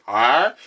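"Ah" as a loud, muffled ouch; mouth-guard garble. Retold or imitated. Patient trying to say ouch through a guard, garbled. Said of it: "there's a guard in my mouth".

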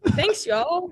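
lightly put-on country accent; country server twang. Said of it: "I turn into some country trick".

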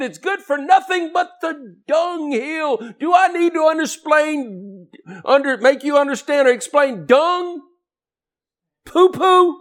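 high, loud shouting preacher; fiery pulpit shouting; full-force preaching. Acted.

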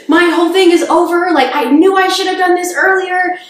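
high, loud, despairing cry of defeat; imitating her own crushed, tearful self; despairing wail. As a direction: wail it like your dream just died. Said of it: "And was like".

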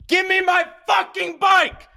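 high, drawn-out furious banshee scream; full-throated raging scream. Retold or imitated. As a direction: scream it at the top of your voice, stretching the words. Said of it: "Like, screaming".

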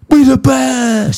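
slow, high, hammed-up "We the best"; [name] impression. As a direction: drag it out like the DJ's catchphrase. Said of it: "I was like".

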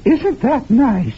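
mock-sweet granny delivering the prize slowly; sugary grandmother. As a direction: coo it proudly like a doting grandma. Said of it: "My grandmother says".

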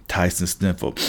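usual speaking voice

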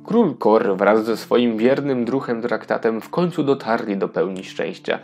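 ordinary talk

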